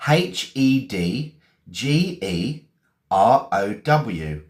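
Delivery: slow letter-by-letter spelling; spelled out. Shown as a demonstration. Teacher spelling hedgerow for learners, slowly letter by letter. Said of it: "I'm gonna spell it".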